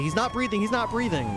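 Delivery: high, urgent mock panic; panicked caller. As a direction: play the frantic caller. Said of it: "They say".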